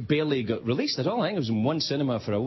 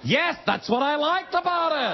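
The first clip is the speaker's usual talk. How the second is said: higher, slow, doubling-down bluff; hammed-up higher, slower fib; bluffing fan; 12 semitones higher than usual. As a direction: save face with a straight-faced lie. Liar doubling down.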